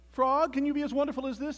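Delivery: high, loud boastful question; excited toad on stage; show-off toad. A narrator's reading. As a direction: call it out while showing off. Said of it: "said Toad as he danced".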